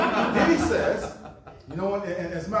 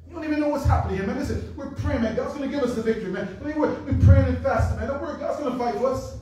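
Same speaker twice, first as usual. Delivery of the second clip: higher, fast, brushing him off; dismissive soldiers; 6 semitones higher, 4 dB louder, faster than usual. Acted. Soldiers brushing off David, higher dismissive voice. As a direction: wave the boy away.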